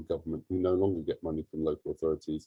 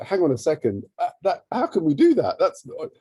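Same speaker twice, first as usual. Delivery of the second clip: higher, louder disbelief; retold incredulous protest; 9 semitones higher, 6 dB louder than usual. Charity head recalls challenging funders, incredulous. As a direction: object as if caught completely off guard.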